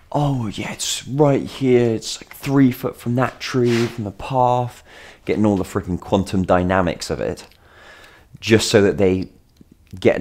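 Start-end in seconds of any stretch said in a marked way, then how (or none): 0.1-4.8 higher, slow, drawn-out pretend pointing-out; play-acting for onlookers. Dog walker pretending for someone watching from a window, slow put-on voice. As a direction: make a show of working out the spot. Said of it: "kind of playing that game of".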